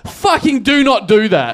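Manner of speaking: slow, loud, every word punched in "do not do that"; emphatic retold plea. Comic warns hecklers off his show, slow emphatic "do not do that". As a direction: hammer each word of "Fucking do not do that" slowly and loudly.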